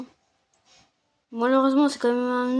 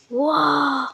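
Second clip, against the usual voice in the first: amazed wow; 5 dB louder than usual. Real, not acted. Amazed at the base loot.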